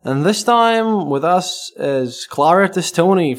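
loud showman greeting; host welcome. Acted. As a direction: welcome the listeners with a flourish. Host's grand opening welcome.

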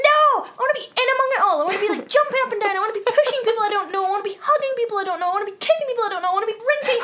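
high, rapid-fire eagerness; excited rant. Retold or imitated. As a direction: rattle off the list, bursting to join in. Acts out her eagerness to join crowd.

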